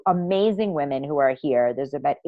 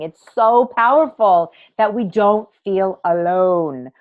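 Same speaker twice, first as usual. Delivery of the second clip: usual speaking voice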